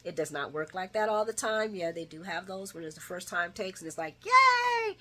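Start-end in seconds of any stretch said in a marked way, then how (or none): 4.2-5.0 high, loud celebratory yay; mock cheer. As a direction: imitate an overjoyed couple's cheer. Mimics couple's cheer at pregnancy.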